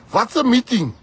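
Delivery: high, loud scornful jab; mock their meeting with a shout. Real, not acted. Scoffing at the DA's meeting talk, loud scornful shout.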